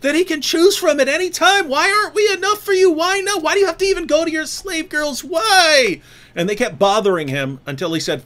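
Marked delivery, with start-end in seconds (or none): 0.0-1.7 pitched-up complaining wife; high mock wife voice. Speaker voices wives mocking a husband, high whine. 1.7-6.0 mock whiny wives. Speaker mimics nagging wives, high whine. Wives complaining, voiced mockingly.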